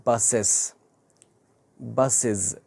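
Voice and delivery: బసెస్ said slowly as "basiz", ending in a z sound; బసెస్ ending clearly in a z; model pronunciation. Shown as a demonstration. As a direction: model బసెస్ with a buzzing final z. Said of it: "బసెస్ అని చెప్పేసి అనాలి".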